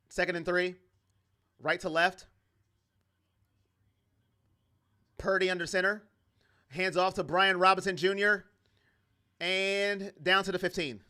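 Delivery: steady game-call delivery; light sportscaster narration; play-by-play call. Acted. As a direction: call the plays like a broadcaster.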